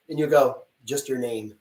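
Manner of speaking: ordinary delivery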